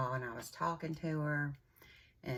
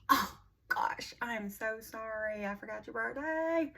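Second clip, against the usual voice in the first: retold horrified oh-no, pitched way up; dismayed apology; 8 semitones higher than usual. Aunt realizing she forgot her niece's birthday, dismayed.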